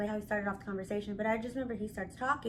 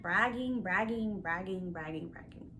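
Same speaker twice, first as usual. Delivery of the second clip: fading mock bragging; 3 semitones lower, 4 dB quieter than usual. Mocking his endless bragging.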